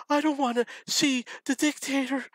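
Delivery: high, wailing mock sob; hammed-up crying voice of a young woman; imitating crying girl. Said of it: "she cried and cried and cried".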